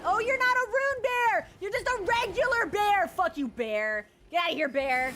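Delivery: furious yelling; scaring off a bear. Real, not acted. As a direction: shout the bear away.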